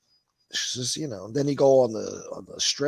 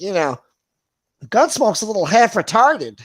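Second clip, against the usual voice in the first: imitating Lee; 9 semitones higher, 10 dB louder than usual. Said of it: "He's like".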